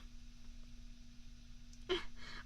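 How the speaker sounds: long, quiet, breathy laugh; soft chuckle. Acted. Joking to a date about bad cooking, soft chuckle. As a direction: chuckle softly at yourself.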